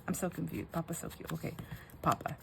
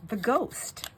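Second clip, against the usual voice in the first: put-on higher, small child's answer; toddler; 5 semitones higher than usual. Toddler telling mom what scared her, imitated child voice. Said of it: "And she goes".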